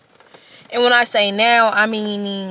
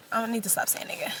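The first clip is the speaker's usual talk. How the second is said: quick unvoiced whisper to herself; whispered aside; 10 dB quieter, much faster than usual. Real, not acted. Correcting herself to viewers, a quick whisper. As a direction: breathe it aside, fast and hushed. Aside under her breath.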